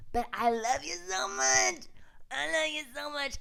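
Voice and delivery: gushing love. Real, not acted. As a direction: coo it to your fans. Sign-off to viewers.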